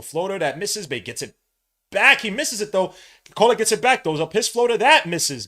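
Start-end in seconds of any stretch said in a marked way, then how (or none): 1.9-5.5 frantic excited yelling. Real, not acted. Frantic play-by-play of rebounds.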